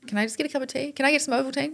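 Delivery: high, soft, polite-asking customer voice; pretend customer request. Asking in the shop for a cup of tea, pretend customer.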